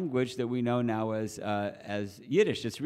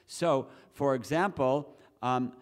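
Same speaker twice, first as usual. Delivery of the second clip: ordinary talk, nothing marked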